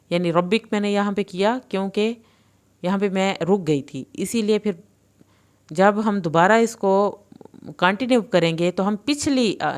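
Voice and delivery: ordinary talk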